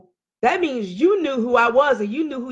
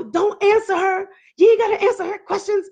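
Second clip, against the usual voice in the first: high, insistent mimicked voice; agitated interjection; 9 semitones higher than usual. Husband telling mistress not to answer wife, agitated. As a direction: butt in quickly, pitched right up.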